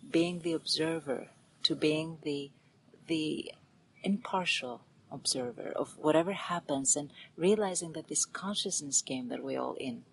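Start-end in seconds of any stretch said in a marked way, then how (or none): none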